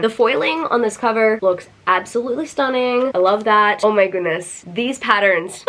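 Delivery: usual speaking voice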